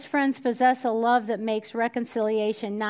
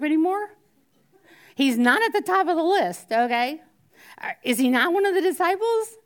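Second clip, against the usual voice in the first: higher and louder, incredulous; mock indignation; 7 semitones higher, 7 dB louder than usual. Preacher mock-outraged Peter is left off the list. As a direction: play up the outrage for laughs.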